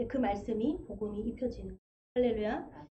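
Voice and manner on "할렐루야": normal talking voice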